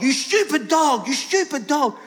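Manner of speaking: hammed-up angry owner; mock yelling. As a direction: scold the dog in a comic yell. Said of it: "he starts yelling at the dog".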